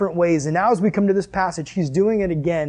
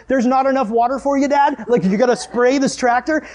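high-pitched, incredulous questioning; mock outrage at dad's nonsense; exasperated sarcasm; 6 semitones higher than usual. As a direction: pile on the sarcastic disbelief. Retold sarcastic exasperation at dad.

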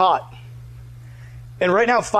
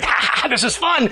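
mock laughter, raised pitch; gleeful prankster; 5 semitones higher than usual. Prankster delighting in his friend's panic, gleeful.

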